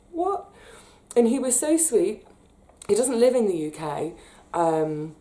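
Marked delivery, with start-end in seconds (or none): 0.1-0.5 stunned. Retold or imitated. Recalling being utterly dumbfounded.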